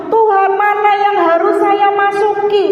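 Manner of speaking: high-pitched imploring question to God; pleading prayer. Retold or imitated. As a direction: re-enact an urgent plea upward. Retold anxious prayer to God.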